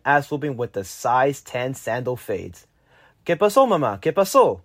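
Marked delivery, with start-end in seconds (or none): 3.3-4.6 urgent shout. Retold or imitated. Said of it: "I shout in urgency".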